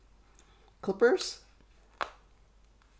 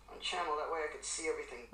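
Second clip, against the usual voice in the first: fast, much softer mutter; muttered under breath; 2 semitones lower, 15 dB quieter, much faster than usual. Real, not acted. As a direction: mumble it quickly to yourself. Repeats line under breath.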